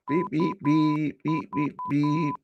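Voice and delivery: slow, spaced-out mock beeps; playful slow beep sound effect; imitated beeping. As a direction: beep it out like a chat alert sound. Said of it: "is that the sound effect".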